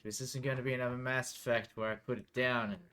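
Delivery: normal talking voice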